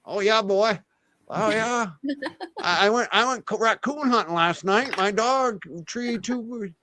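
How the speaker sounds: high, hammed-up rural New England yokel; drawling backwoods hunter, played for laughs; country accent. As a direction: lay on a thick country Byfield twang. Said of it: "a country".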